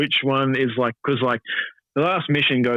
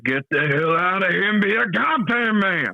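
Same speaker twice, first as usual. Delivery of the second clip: quoting a game character; 8 semitones higher than usual. Retold or imitated. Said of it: "I'd be like, quoting Red Dead".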